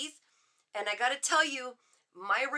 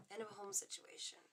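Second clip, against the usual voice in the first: breathy, unvoiced whisper of End of a home situation; softer whispered musing; whispering to herself; 18 dB quieter, faster than usual. Real, not acted. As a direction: mutter it to yourself while absorbing the card. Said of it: "am I doing that out loud? Is that my, uh, outdoor voice inside?".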